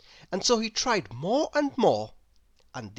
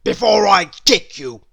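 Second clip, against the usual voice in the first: much louder gruff threat; angry threat; 2 semitones higher, 10 dB louder than usual. Acted. Mister Grumpy threatening to kick Mister Happy. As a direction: spit the threat out furiously. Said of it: "snapped Mister Grumpy".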